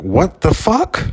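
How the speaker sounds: high, drawn-out incredulous question; retold outraged disbelief. Retelling his protest to police taking him, incredulous. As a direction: stretch it way up in disbelief.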